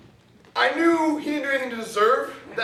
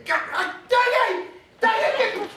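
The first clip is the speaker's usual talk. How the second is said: put-on whiny kid yell; 11 semitones higher, slower than usual. Kid yelling, brother mocking.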